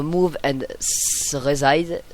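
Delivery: English words in a heavy French accent. Real, not acted. Tutorial maker reading a menu name aloud, French-accented English. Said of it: "mon accent pourri en anglais".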